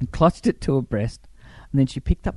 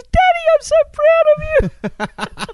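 put-on high, louder little-girl voice; proud daughter; 6 dB louder than usual. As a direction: gush with pride like a teenage daughter. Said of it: "she goes".

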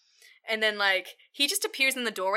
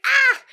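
short high loud cry of pain; mock scream; 12 dB louder than usual. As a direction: yelp as if hit on the head. Gentle man hit by roller door.

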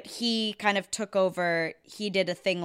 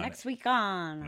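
put-on slow, drawn-out old-time announcer; Mid-Atlantic announcer voice; 3 dB quieter, slower than usual. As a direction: read it like a 1950s TV announcer. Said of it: "Mid-Atlantic announcer voice comes on".

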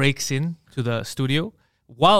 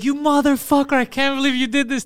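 mock angry viewer; 8 semitones higher than usual. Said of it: "people were like".

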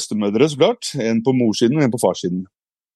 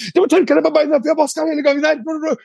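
very high-pitched nagging grandmother; imitated scolding; 14 semitones higher than usual. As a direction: tell the child off in a put-on high voice. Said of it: "hun kjefter meg ned". (etterlignet kjefting fra bestemor)

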